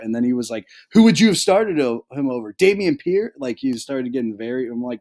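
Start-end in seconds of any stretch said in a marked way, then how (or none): 0.9-3.3 a high, loud, incredulous imitation of Greg; mock outrage, pitched up and pushed loud; indignant retort. Imitating Greg snapping back at a friend, incredulous and loud. Greg's indignant reply retold.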